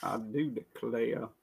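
put-on Southern accent. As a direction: echo it quietly in a Southern accent. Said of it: "That is the way Southern people talk".

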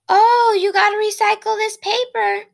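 high, loud sing-song sneer; mock her like a smug bully; mocking taunt. Classmate taunting her while dumping her notebook, high mocking voice.

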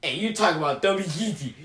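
ordinary talk

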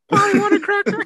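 high loud squawking parrot. Pretend. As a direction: screech it like a parrot. Imitating a parrot again.

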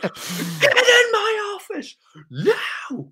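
high, strained mock scream, drawn out; furious teacher. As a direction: imitate an enraged teacher ordering someone out. Retold furious teacher shouting an order.

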